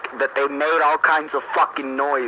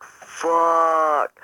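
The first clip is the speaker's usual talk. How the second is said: higher, long-held swear of dismay; drawn-out dismayed curse; 5 semitones higher, 3 dB louder than usual. Retold or imitated. Son caught by his mom, drawn-out dismayed curse. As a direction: stretch the word out, caught red-handed. Caught, sinking realization, acted.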